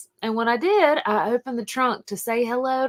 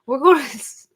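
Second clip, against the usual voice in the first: laughing quote; 8 semitones higher, 10 dB louder than usual. Retold or imitated. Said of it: "And she said".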